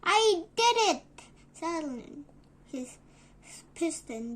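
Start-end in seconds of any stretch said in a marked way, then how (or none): 0.0-1.0 triumphant cry. Acted.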